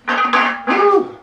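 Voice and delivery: high woo. Real, not acted. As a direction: whoop out the relief. Relief after pepper challenge.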